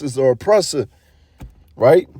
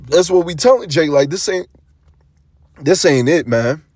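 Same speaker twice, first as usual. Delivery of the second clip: usual speaking voice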